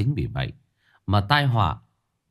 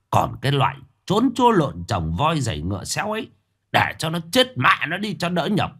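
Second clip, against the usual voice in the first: much higher, louder furious tirade at his wife; furious outburst; 6 semitones higher, 3 dB louder than usual. Acted. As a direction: slam the table and rage at her. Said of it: "ông đập bàn".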